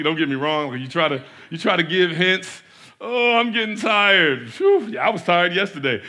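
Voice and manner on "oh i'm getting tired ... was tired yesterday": mock tired hint. Dropping hints to leave.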